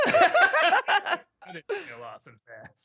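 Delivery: slow, high, laughing through the words. Real, not acted. Husband answers the chat joke while laughing, high and slow. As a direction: barely get it out for laughing.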